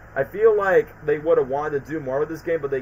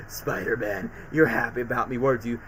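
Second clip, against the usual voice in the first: lower, softer sneering villain; sinister villain; 5 semitones lower, 5 dB quieter than usual. Retold or imitated. Dying Cletus taunting Spider-Man, lightly voiced sinister. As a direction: taunt him from the ground.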